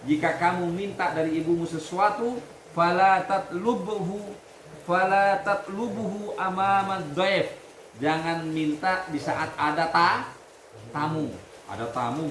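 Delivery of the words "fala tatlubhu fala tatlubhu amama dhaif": slow, loud, higher reading chant; Arabic recitation. Acted. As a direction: intone the Arabic text slowly for the class. Teacher reads Arabic text aloud.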